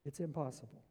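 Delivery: resigned mutter. Acted. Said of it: "scratching their head saying".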